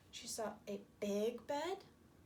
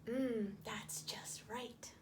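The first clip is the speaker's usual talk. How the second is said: satisfied whisper; 3 semitones lower, much slower than usual. Acted. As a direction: breathe it with contentment. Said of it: "and she said".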